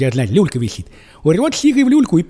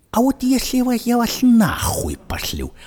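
hushed, higher, slow whispered defiance; mock whisper; 6 semitones higher, slower than usual. The hero whispers his defiance to the agents. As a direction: breathe the threat out slowly.

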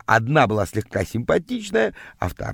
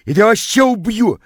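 enraged; 3 dB louder than usual. A narrator's reading. Enraged rival spots the narrator and threatens him, enraged. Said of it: "увидев меня, озверел".

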